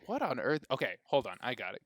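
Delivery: ordinary delivery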